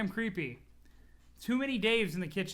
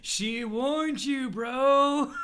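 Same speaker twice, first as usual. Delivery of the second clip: drawn-out, louder sing-song; slow gloating taunt; 5 semitones higher, 7 dB louder, much slower than usual. Acted. Gloating at Dave one last time, slow and loud. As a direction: savour the meme line.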